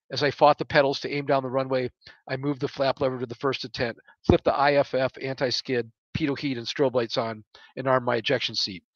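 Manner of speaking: ordinary delivery, nothing marked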